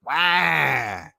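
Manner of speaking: drawn-out mock "wah" wail; mock baby cry. Speaker mocking people who cry like a baby, mock wail. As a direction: imitate a bawling baby. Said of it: "go all out like a baby".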